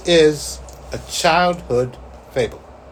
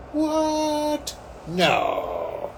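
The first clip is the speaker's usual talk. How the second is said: stunned disbelief; 3 semitones higher than usual. Retold or imitated. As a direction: replay utter shock, high then sinking. Reacting in disbelief to the news.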